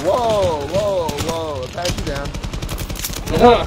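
very slow, stretched words; slow drawl. Real, not acted. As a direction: drag every word out. Drawn out joke.

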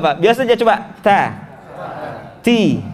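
ordinary talk, nothing marked

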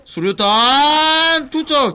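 slow, loud drawn-out callout; grenade warning yell. Real, not acted. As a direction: call the grenade throw out loud. Calling out grenade throw.

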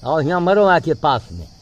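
loud, urging call to come nearer; calling out to a monkey. Real, not acted. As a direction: bark the monkey over, impatient. (ज़ोर से पास बुलाना)

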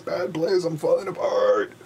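a mock-despairing wail over the board. Real, not acted. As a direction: wail that you're falling apart. Lament builds into a cry.

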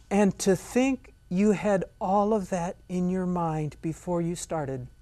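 lightly voiced gushing fan; mock awed admirer. Admiring viewer praising the painter, slow mock awe. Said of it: "they'll say something like".

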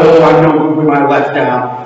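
usual speaking voice

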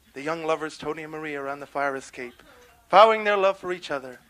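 usual speaking voice

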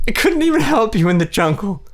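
weeping self-blame; crying. A narrator's reading. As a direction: sob it out, then sink into defeat. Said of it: "She kept crying".

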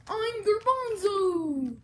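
high, loud, slow hero cry; loud character shout. Pretend. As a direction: belt the name out slowly. Toy character announces itself.

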